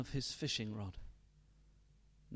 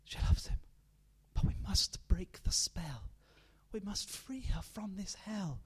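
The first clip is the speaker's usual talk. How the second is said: put-on hushed, breathy plotting between children; secret whisper; 6 semitones higher than usual. Children whispering a plan to free their mother. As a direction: whisper it so the stepfather can't hear.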